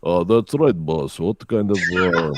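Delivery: put-on thick Russian accent. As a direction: play a Russian workman addressing the boss. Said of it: "Can you do a Russian accent?".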